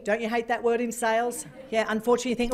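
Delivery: ordinary talk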